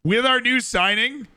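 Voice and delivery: ordinary talk, nothing marked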